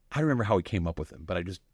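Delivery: ordinary talk, nothing marked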